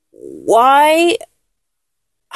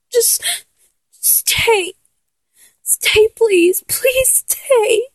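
tearful begging; 6 semitones higher than usual. Acted. Begging the partner not to get dressed and leave, tearful. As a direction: beg through tears for them to stay.

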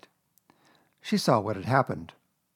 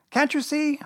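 very high little shout; Piglet shouting; 4 dB louder than usual. A narrator's reading. Piglet demanding Kanga look properly, shouting. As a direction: shout it in a squeaky small voice. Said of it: "shouted Piglet".

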